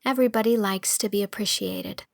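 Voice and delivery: ordinary delivery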